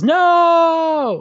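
long high drawn-out wail; yelled no. Retold or imitated. Host imitating Dan watching Rorschach die, hammed-up long yell. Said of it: "he just yells".

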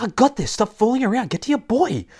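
high, urgent scolding order; bossy battle command. Acted. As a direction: tell him off mid-fight. Wilma barks orders while fighting.

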